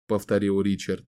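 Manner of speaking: usual speaking voice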